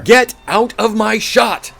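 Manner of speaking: loud, slow angry bark; angry shout. Acted. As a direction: yell him out of the frame. Reporter yells at intruder.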